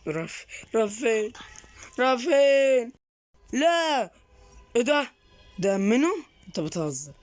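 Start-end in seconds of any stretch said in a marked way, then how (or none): 0.1-5.1 high, fast, alarmed questions; rattled and panicking. Real, not acted. Scared by possessed boy.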